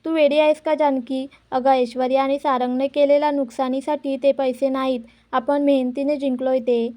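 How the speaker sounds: plain reading voice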